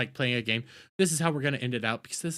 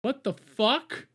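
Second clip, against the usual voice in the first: 1 stunned disbelief; 6 semitones higher, slower than usual. Real, not acted. Repeated stunned reaction to clip.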